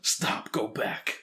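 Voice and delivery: hammed-up Hollywood action American; bad American accent. Shown as a demonstration. Co-host asks, he repeats it in a bad American accent. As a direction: redo the cheesy American movie line on request. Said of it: "do it again".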